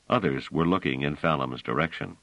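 ordinary delivery

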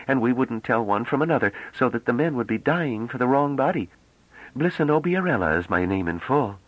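high-pitched, animated explanation; lively young woman. Acted. Young woman explains her long name, lively. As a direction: explain with high-voiced indignation. Character voice of Bliss.

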